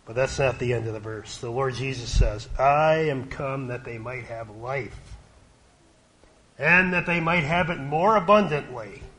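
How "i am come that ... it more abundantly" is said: scripture quote. Acted. Preacher quoting Jesus to the church, swelling with joy. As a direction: build the promise as it goes.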